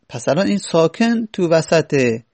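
ordinary talk

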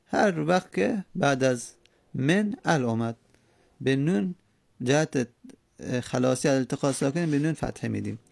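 normal talking voice

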